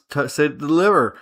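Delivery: normal talking voice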